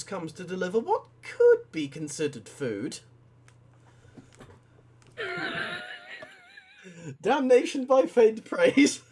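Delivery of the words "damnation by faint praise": laughing. Real, not acted. Reading an absurd AI script.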